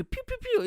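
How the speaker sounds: very high-pitched pew sounds; playful toy-gun shooting noises; squirt gun noises. Pretend. Pretend border guard firing a squirt gun, squeaky pews.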